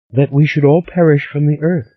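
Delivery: put-on high, lively girlish voice; a lightly voiced excited young Stella; eager young woman. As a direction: spill the gossip breathlessly.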